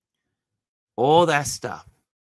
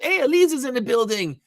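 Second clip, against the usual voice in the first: much higher, quick host call-out; excited greeting; 3 dB louder, faster than usual. Real, not acted. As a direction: shout the welcome like a hype man. Greeting viewer arrival.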